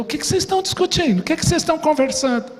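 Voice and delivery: Jesus asking the travellers, lightly voiced; curious question. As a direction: sound like a stranger who wants to know. Jesus asks on road to Emaús.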